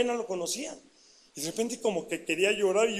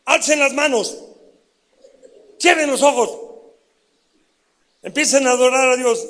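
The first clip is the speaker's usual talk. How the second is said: louder, higher call to worship; retold pastor's command; 4 semitones higher, 10 dB louder than usual. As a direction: lead the room in worship.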